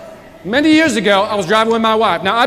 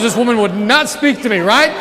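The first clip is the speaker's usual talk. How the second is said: ordinary talk, nothing marked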